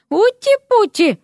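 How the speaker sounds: high, slow cutesy cooing; cooing baby talk. A narrator's reading. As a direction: coo it high, drawn out, as to a cute little pet. Baby-talk cooing over a frog. (сюсюкая, как с малышом)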